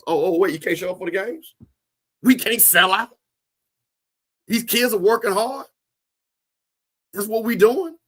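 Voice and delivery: a hint of a coach scolding absent alums; calling out the alums. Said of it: "He be calling out the alums".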